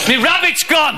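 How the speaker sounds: high-pitched comic dismay; mock alarmed magician. Magician finds his rabbit missing, high mock alarm. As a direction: announce a disaster in mock panic.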